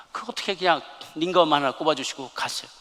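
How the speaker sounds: normal talking voice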